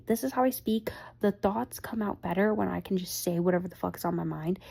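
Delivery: ordinary talk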